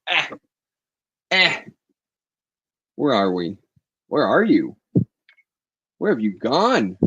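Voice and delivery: normal talking voice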